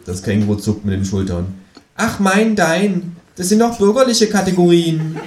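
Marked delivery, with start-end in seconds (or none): 2.0-5.2 high, sharp kangaroo voice; cheeky high kangaroo. A narrator's reading. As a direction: pitch the kangaroo up and sassy.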